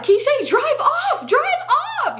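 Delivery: very high, drawn-out imitation shriek; mock panicked scream. Friend screaming at the driver to flee, hammed-up high panic. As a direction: mimic a frantic friend, stretching each word.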